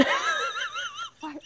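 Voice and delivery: breaking into real laughter; laughing while trying to talk. Real, not acted. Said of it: "I lost it".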